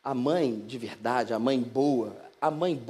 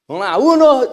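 loud, high cheer mid-game; empolgado no jogo; 9 semitones higher, 15 dB louder than usual. Acted. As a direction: shout it out to the card table. Jesus playing Uno with friends. (gritando empolgado no jogo)

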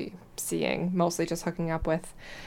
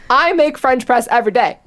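higher and louder, fed up and insistent; exasperated retort; 5 semitones higher, 10 dB louder than usual. Retold or imitated. Retelling how she snapped back at the mansplaining date, exasperated. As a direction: push back hard, annoyed he won't listen. Her retorted reply to the lecture.

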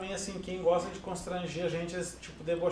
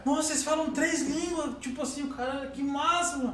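higher, drawn-out admiration; imitated amazement; 6 semitones higher, slower than usual. Retelling a gas station worker's surprise at three languages, amazed. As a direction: play an impressed stranger, lightly.

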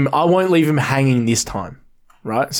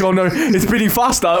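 higher, mock-anxious wail; mock panic; 5 semitones higher than usual. Co-host imagining the sufferer's racing heart, mock panicked. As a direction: play up the panic.

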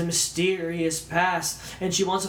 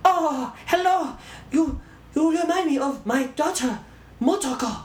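exaggerated Japanese-accented English, pitched way up; mock Japanese accent; 11 semitones higher, 3 dB louder than usual. Film fan copying the mom's line, in mock Japanese accent. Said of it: "the offensive accent just to copy it".

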